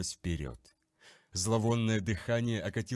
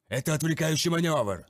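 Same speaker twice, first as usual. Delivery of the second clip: loud commanding shout, higher pitch; shouted warning; 6 semitones higher, 7 dB louder than usual. Acted. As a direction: bark it across the battle. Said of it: "предупредил капитан". (громкий командный крик)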